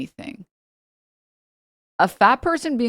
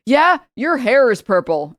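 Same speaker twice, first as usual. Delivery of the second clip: louder, higher dumb comment; mock chud; 6 semitones higher, 7 dB louder than usual. Quoting a chud commenter, mock loud. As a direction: say it like a smug troll.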